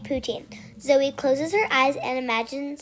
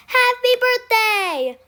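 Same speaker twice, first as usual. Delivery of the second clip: loud, high, stretched surprise shout; yelled greeting; 11 semitones higher, 6 dB louder, slower than usual. A narrator's reading. Relatives surprise the birthday girl, high loud slow yell. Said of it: "everybody yells".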